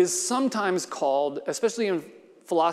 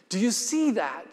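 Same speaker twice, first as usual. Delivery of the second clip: shouting; 5 semitones higher than usual. Acted. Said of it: "shouted the stranger".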